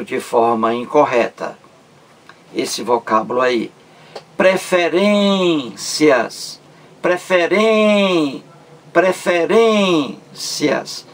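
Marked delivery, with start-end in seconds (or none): 4.3-6.6 "Preferências" slow, with "rên" held high; stressed syllable drawn out. Shown as a demonstration. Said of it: "A sílaba mais forte é". 7.0-8.4 "Preferen-" slow and higher, holding "ren"; stressed syllable drawn out. Shown as a demonstration. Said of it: "A sílaba mais forte é". 8.9-11.1 "preferências" slow, higher, "rên" stretched; stressed syllable drawn out. Shown as a demonstration. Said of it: "A sílaba mais forte é".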